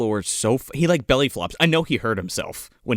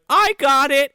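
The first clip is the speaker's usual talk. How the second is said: high, loud mock kid voice; hammed-up high-pitched child; little kid; 12 semitones higher, 5 dB louder than usual. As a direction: imitate an eager kid calling out. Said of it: "the little kid's like".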